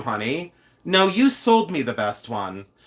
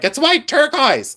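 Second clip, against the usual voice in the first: mocking know-it-all; 12 semitones higher than usual. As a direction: parrot the know-it-all in a much higher voice. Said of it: "someone's like".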